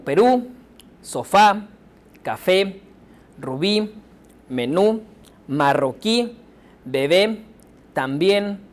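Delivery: word list from Perú to canción, each landing on its final syllable, short pauses between; stress on last syllable. Shown as a demonstration. Teacher listing examples of the rule, final-syllable stress from Perú to canción. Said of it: "sílaba tónica está al final".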